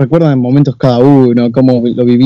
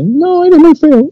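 put-on very high, slow little girl; retold girl in a high childish voice; much slower than usual. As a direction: sing it out like a small child.

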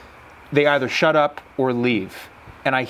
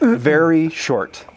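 drawn out slowly; 2 semitones higher, 3 dB louder, much slower than usual. Pretend. Mock-slow punchline of the idiom.

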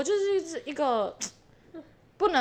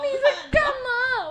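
laughing mock baffled; 11 semitones higher, slower than usual. Husband's baffled question.

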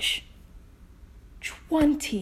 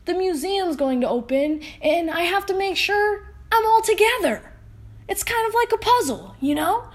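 higher, fast pleading dinosaur voice; higher-voiced worried T-Rex; 7 semitones higher, 4 dB louder, faster than usual. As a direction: beg for the fossil, high and quick. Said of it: "And T-Rex said".

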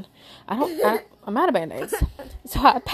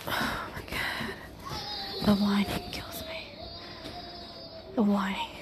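slow, breathy whisper of exasperation; exasperated whisper; 8 semitones higher, 7 dB quieter, much slower than usual. Real, not acted. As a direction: sigh it out in a whisper. Exasperated whisper about whining.

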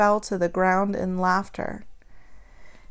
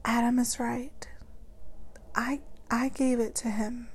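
frightened, much softer whisper; barely able to speak; 11 dB quieter than usual. A narrator's reading. Eve confesses to Papa, frightened whisper. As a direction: whisper it, too scared to speak up. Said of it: "I was so afraid I could barely speak".

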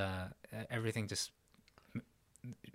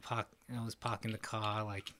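dropped-R Boston accent, lower and quiet; Boston accent, R-less "pahk the cah"; 4 semitones lower, 6 dB quieter than usual. Shown as a demonstration. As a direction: do a Boston accent with broad vowels. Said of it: "Then I got a Boston accent".

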